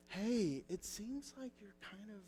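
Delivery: put-on wife whispering to her yelling kids, gently; soft whisper. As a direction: drop almost to a whisper to calm the yelling kids. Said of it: "she'll start whispering".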